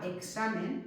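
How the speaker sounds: "examen" with the K sounded at syllable end; "examen", X as a full KS; K before S. Shown as a demonstration. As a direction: say "examen" as ek-SA-men. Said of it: "para pronunciar esta K".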